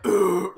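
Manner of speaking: low, loud mock "ugh"; imitated loud grunt. Mimicking the switch after the sparkles, loud low grunt. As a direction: drop low and blurt a grunt. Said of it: "and it went like".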